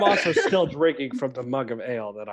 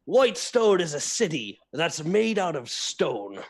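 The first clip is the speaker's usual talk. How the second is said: put-on high, lilting drunken pirate storyteller; put-on pirate brogue, pitch swooping up; 6 semitones higher than usual. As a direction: spin a grand yarn like a tipsy sea dog. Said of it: "I go".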